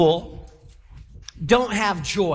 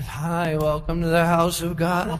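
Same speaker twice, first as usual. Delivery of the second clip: funereal greeter; 5 semitones lower than usual. Acted. Church greeter welcoming people as if at a funeral, gloomily hushed. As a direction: imitate a joyless greeter, pitched down and subdued. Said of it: "I feel like I'm at a funeral home".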